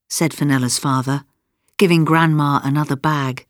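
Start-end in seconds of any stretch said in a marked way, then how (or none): none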